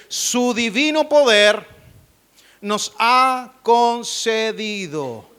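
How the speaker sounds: very slow, weighty preaching read; emphatic scripture proclamation. Acted. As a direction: proclaim each word slowly to the congregation.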